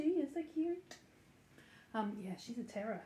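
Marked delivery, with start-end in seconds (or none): 0.0-1.0 higher cooing voice. Real, not acted. Owner coos at the puppy, higher baby talk. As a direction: coo it at the pup.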